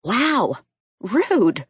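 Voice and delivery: loud playful outrage; mock-offended exclaim. A narrator's reading. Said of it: "Clara exclaims".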